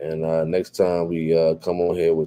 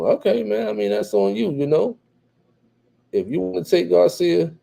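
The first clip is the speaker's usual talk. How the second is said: much higher, sing-song dismissive tease; teasing okay; 10 semitones higher than usual. Real, not acted. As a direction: shrug it off in a high mocking lilt. Teasing reply to chat pick.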